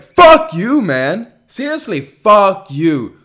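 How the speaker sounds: loud, high furious curse; angry outburst. Real, not acted. Cursing out dating gurus, angry and loud.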